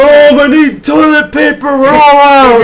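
very high, loud, drawn-out whine; kid yelling for mom. Acted. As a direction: holler from the bathroom like a kid.

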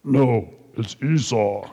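lightly disguised voice pretending to be his brother. Jacob lying to blind Isaac, a mildly disguised voice. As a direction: fake it a little, a son posing as his twin. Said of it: "I'm sure he's disguising his voice".

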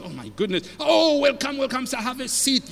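imitated startled, gushing welcome; mock-astonished usher greeting a guest; shocked ushers. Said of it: "They were shocked".

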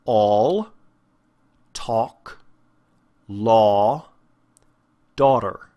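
"all", "talk", "law", "daughter" with rounded aw; resonant aw vowel. Shown as a demonstration. Teacher gives example words, slightly British-feeling rounded aw in "all", "talk", "law", "daughter". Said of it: "probably a little more resonant than what you're used to".

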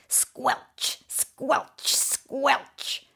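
soft unvoiced squelch; squelchy whisper. A narrator's reading. Melting icicle boots, a soft whispered squelch.

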